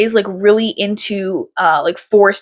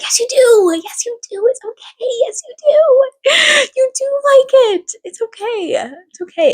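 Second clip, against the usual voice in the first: hammed-up panicked pleading with little kids; frantic reassurance; 13 semitones higher than usual. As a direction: insist desperately in a squeaky high voice. Desperately reassuring kids about slugs.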